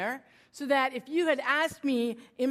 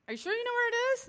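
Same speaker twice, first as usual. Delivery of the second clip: doubtful teasing question; 13 semitones higher, much faster than usual. Retold or imitated. Husband doubting her.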